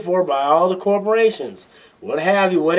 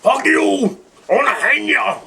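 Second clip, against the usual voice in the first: high shouted mock threat; hammed-up hateful emailer; mock hate-mail threat; 6 semitones higher than usual. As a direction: yell it like a furious troll. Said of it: "that's just the email I get".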